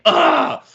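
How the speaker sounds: loud mock groan of annoyance; frustrated groan. Girlfriend annoyed she didn't catch him, loud mock groan. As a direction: let out a loud fed-up "ugh".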